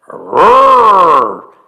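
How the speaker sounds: bear roar. Acted. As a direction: roar like a big friendly bear. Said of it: "the bear said".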